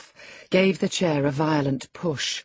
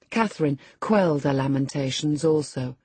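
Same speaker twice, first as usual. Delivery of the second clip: ordinary delivery, nothing marked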